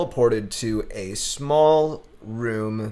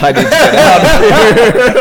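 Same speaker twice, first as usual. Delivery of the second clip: very high, loud panicked yell with laughter; mock freak-out; 10 semitones higher, 14 dB louder than usual. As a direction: shriek to escape, cracking up. Roommate freaking out in the room.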